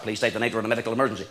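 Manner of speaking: a quick deadpan hologram doctor impression; mock hologram doctor. Comedian quoting the Star Trek doctor, mock robotic.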